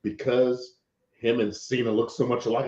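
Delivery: ordinary delivery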